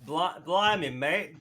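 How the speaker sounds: badly faked British, stumbling in; attempted British accent. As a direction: have a shaky go at a British accent. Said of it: "I'm trying to say British phrases".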